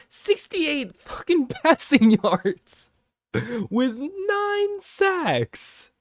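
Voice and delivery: high, slow, stretched disbelief with a laugh; exasperated high wail; incredulous squeal. Real, not acted. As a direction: drag it out in stunned disbelief. Exasperated rant over the stats.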